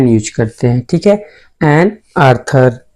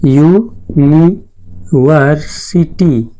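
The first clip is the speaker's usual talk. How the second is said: University very slowly, as यू... नि... वर... सि... टी; syllable-by-syllable pronunciation; much slower than usual. Shown as a demonstration. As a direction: break university into long, separate syllables. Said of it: "इसका pronunciation देखेंगे". (University को अक्षर-अक्षर अलग करके धीरे बोलें)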